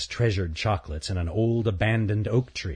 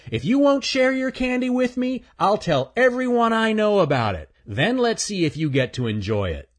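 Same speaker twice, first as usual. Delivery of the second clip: lightly raised, cocky chipmunk voice; a smug little chipmunk; teasing chipmunk; 13 semitones higher, 4 dB louder than usual. Acted. Earl's voice.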